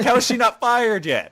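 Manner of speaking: loud, high-pitched disbelief; incredulous. Real, not acted. Viewer reacting to the nurse, loud incredulity. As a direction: exclaim in amused outrage.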